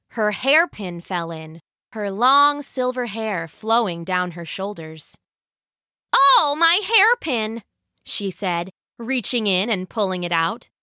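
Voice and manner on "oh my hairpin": high, drawn-out "oh"; surprised little lament, pitched up; dismayed exclamation. Acted. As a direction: exclaim slowly in a high wife's voice. Wife loses her hairpin.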